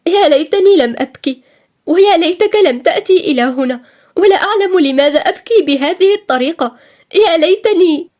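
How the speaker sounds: high, loud, tearful and trembling. A narrator's reading. Crying woman regrets her tears to the man comforting her, trembling. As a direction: wail it with a trembling voice. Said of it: "وأجابته بصوت مرتجف".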